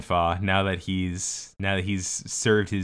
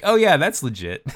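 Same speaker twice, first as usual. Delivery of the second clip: mock casual; 9 semitones higher, 7 dB louder than usual. Guard shrugging off fake confession.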